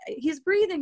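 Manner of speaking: high, loud eager cheer; retold encouraging yell. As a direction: cheer him on, eager and bright. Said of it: "it's like, for encouragement".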